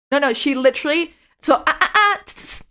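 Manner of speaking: high scolding ah-ah with tongue clicks; disapproving tsk sounds. Retold or imitated. Imitating the roommate's scolding clicks, lightly. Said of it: "treat me with just sounds".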